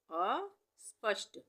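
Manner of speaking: अस्पष्ट very slow, अ apart from स्पष्ट; broken-up dictation. Shown as a demonstration. Teacher dictating a spelling word, अस्पष्ट split after अ. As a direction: say अ, pause, then स्पष्ट.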